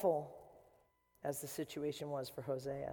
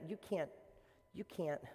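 ordinary talk, nothing marked

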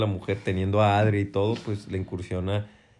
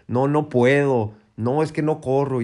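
mock excuse voice; 4 semitones higher, 4 dB louder than usual. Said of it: "pero el".

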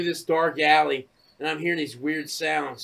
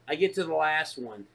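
ordinary talk, nothing marked

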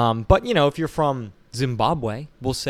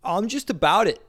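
higher, odd put-on dude voice; goofy stranger voice; 9 semitones higher than usual. Stranger answering Beau at the bar, goofy higher voice. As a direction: play the clueless party guy.

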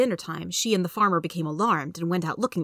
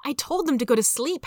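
put-on higher-pitched boy making excuses; defensive boy; 7 semitones higher than usual. A narrator's reading. Son defending himself to his father, defensive. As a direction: protest like a kid, voice up.

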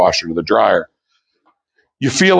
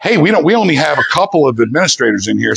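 ordinary talk

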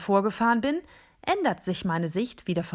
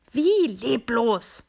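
slow, louder indignant snap; snapping; 4 semitones higher, 5 dB louder, much slower than usual. Acted. Robot snapping at the figure for calling it lifeless. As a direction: bite back at the insult. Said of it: "schnauze ich".

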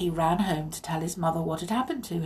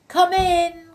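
a loud, high call from another room; 10 semitones higher, 8 dB louder than usual. A narrator's reading. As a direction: call them in from inside, raised and high. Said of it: "cried a voice".

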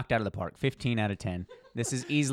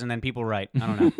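usual speaking voice

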